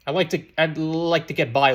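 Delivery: ordinary delivery, nothing marked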